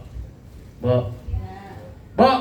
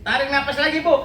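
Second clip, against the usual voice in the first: mock doctor coaching; 11 semitones higher than usual. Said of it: "Kata dokter". (dokter memandu napas ibu, nada tinggi)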